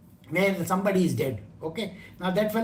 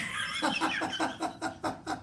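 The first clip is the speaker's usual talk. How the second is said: airy unvoiced laugh; laughing at his own joke; breathy laugh; 8 semitones higher, 8 dB quieter than usual. Real, not acted. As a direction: burst of laughter. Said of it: "laughs after joke".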